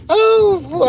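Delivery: high, loud exclamation after laughing; real helpless, high-pitched sigh of hilarity; overcome with amusement. Real, not acted. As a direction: cry out as if you can't take it. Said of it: "I can't take it".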